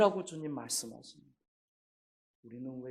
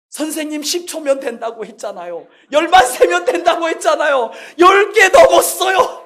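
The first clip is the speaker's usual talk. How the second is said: put-on rising to a high, loud child's complaint, slowing at the end; whine-shout that the count is over; protesting child; 10 semitones higher, 15 dB louder than usual. Boy protesting to the doctor, building to a high loud wail. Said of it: "다성이가 말했어요".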